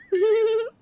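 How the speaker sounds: short high-pitched giggle; habitual giggle. Acted. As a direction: let a small high laugh slip out. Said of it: "तेरी तो आदत ही है". (छोटी, ऊँची खिलखिलाहट)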